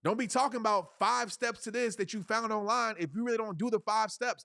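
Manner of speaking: ordinary talk, nothing marked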